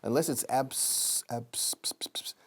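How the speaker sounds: abs- broken off with the b softened almost to a p, ps ps whispered; soft B as P. Shown as a demonstration. Teacher isolating the b of absolutely. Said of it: "la B de absolutely casi suena como una P".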